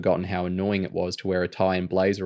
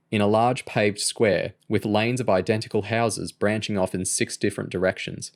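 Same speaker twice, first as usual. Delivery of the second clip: ordinary delivery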